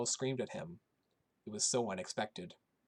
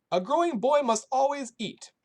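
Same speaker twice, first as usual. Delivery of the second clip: put-on Polish accent; 13 semitones higher, 9 dB louder than usual. A narrator's reading. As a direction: stress on boy in a high Polish accent. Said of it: "in her thick Polish accent".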